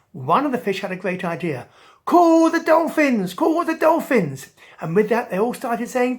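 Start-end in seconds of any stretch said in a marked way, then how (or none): 2.1-4.5 calling out. Acted. Fish calling for help.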